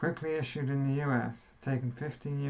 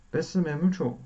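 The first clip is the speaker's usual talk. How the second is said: mock Italian accent; 3 semitones higher, 4 dB louder than usual. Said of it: "I can't do an Italian accent".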